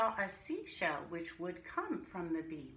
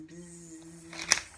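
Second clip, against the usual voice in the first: buzzing bee noise; 3 semitones lower, 5 dB louder than usual. Acted. Imitating humming wings.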